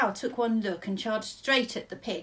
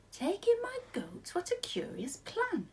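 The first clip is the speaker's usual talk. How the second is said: put-on high, slow, doubting old lady; puzzled old lady; 12 semitones higher, 3 dB quieter than usual. A narrator's reading. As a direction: squeak it out in disbelief, slowly.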